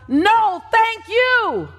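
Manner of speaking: high, loud call of thanks from far back; mock yell from crowd. Audience member shouting thanks to the speaker, high and loud. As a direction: call it out across the stadium. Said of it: "yells out".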